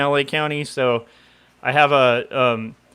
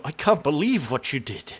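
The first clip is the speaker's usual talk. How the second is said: Scottish movie-star impression, softer than usual talk; Sean Connery; 5 dB quieter than usual. Shown as a demonstration. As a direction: put on an old Scottish screen-legend voice. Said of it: "I can do that".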